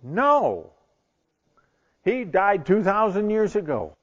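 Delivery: emphatic denial. Acted. Rabbi rejecting his disciples' question, exasperated. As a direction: shut the question down firmly.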